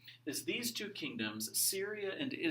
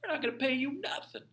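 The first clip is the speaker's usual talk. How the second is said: high mocking taunt, cheeky and defiant; mock the rebels in a squeaky sassy voice; much higher, snotty kingdom refusing to pay; smart-aleck defiance. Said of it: "they get all smart and like".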